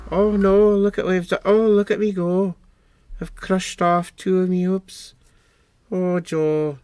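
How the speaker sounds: high, loud hammed-up horror at his own mess; comic oh-no wail; mock dismay. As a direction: squeal in playful despair over the broken hoops. Mock dismay at own clumsy crafting.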